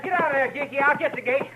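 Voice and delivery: normal talking voice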